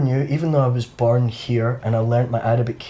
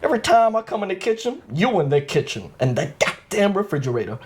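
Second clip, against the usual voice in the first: put-on American stoner accent; 8 semitones higher than usual. Imitating American stoner movies for a friend, high comic accent. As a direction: do it like a character from Friday. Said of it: "So I always do that accent".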